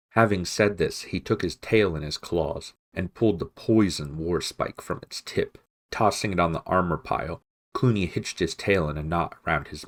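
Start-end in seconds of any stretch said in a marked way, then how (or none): none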